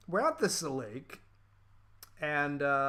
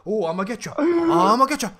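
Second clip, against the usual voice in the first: playful chase; 7 semitones higher, 10 dB louder than usual. Retold or imitated. Acted playful chase quote.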